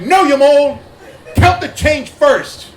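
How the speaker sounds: high, loud bark with slow, hard-hit words; scolding coworker. Retold or imitated. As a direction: yell the correction at a clumsy trainee. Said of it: "they'd be like".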